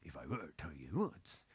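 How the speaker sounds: lightly voiced golfer trying on a new idea; imitating Faldo. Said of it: "he stood like Tiger Woods and said".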